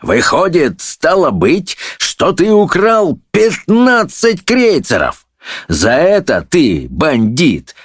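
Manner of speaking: high, ranting verdict; indignant high-pitched tirade; shouting judge. A narrator's reading. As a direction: pronounce sentence, stretching the key words. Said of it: "закричал судья на волшебника".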